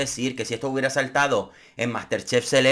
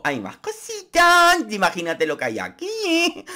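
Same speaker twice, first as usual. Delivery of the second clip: mocking Marta; 9 semitones higher, slower than usual. Mocking Marta's teasing remark.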